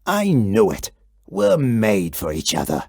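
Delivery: very low, pleased monster growl. A narrator's reading. Gabe growls contentedly at the kid, very low. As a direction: rumble it out, happy to be wanted. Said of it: "he growled".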